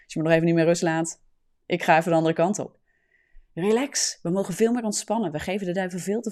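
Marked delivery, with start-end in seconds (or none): 0.0-2.8 loud, offhand send-off; breezy dismissal. Acted. Believer brushing off a nagging voice, breezy dismissal. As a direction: call it back while walking away, mock-casual.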